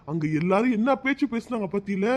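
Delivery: normal talking voice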